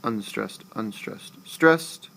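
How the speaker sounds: weak, weak, STRONG on unstressed, unstressed, stressed; anapestic rhythm. Shown as a demonstration. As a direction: drop both unstressed, punch the final stressed. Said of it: "Anapestic foot".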